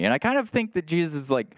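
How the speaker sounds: usual speaking voice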